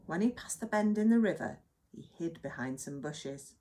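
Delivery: usual speaking voice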